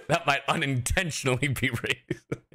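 words breaking into a laugh; real giggles while speaking. Real, not acted. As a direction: talk through laughter. Still laughing at own joke.

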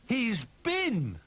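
high, loud rabbit squeal of delight; excited storybook rabbit; excited cry. A narrator's reading. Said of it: "cried Rabbit".

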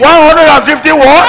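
incredulous cry at the huge bill; shocked disbelief. Acted. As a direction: echo the price back in shock. Shocked at the hospital price.

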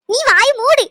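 high, loud "shut your mouth"; furious shout to silence her; angry shout. Acted. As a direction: cut her off with a furious yell. Family quarrel, silencing someone.

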